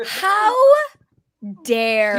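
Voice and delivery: HOW and DARE split by a dramatic pause; hammed-up affront, word by word; mock outrage. Host answering teasing co-hosts, theatrically indignant.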